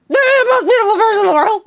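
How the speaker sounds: high, fast breathless blurt; rushed high gushing compliment; awkward gush. Retold or imitated. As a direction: spill it out way too fast. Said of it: "so awkward".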